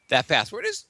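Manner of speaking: ordinary talk, nothing marked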